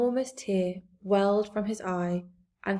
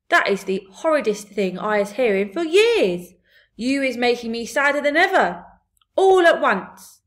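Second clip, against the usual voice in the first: loud outburst, climbing high at the end; big friendly giant, upset; 4 semitones higher, 8 dB louder than usual. Acted. BFG hears of Sophie's suffering, loud upset giant voice. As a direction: wail it in dismay, building higher.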